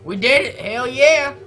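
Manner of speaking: excited cheer. Real, not acted. Dodged damage, celebrates.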